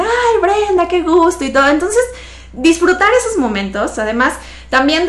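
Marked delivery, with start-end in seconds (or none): 0.0-1.4 cheerful clinic staff. Retold or imitated. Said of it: "todos así de".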